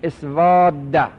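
slow teaching pronunciation; slow demo of the word. Shown as a demonstration. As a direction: draw out اسوده for the class. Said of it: "بگید".

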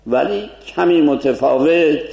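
ordinary talk

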